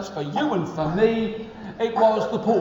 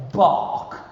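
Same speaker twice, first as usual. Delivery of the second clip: whispered bark; 4 dB louder than usual. Acted. Duck learns to bark.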